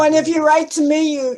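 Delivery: ordinary talk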